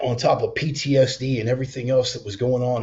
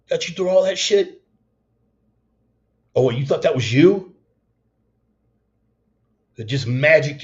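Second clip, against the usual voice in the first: mocking rebuke; 3 semitones higher than usual. Mocking himself as the voice.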